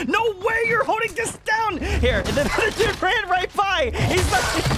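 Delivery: high, drawn-out cry of disbelief; incredulous shout. Real, not acted. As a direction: exclaim slowly in real amazement. Disbelief at a player's brazen move.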